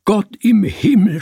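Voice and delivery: high, loud cry to heaven. A narrator's reading. Terrified man cries to God, shouting high. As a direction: shout it out in alarm. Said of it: "schrie er auf".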